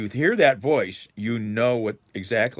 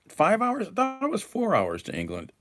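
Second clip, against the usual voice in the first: surprised; 13 semitones higher than usual. Real, not acted. Surprised at flight time.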